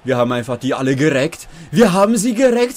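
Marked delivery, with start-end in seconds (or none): 1.7-2.8 excited. Real, not acted. Hyped about yesterday's Rocket League win.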